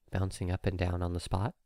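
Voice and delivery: ordinary delivery, nothing marked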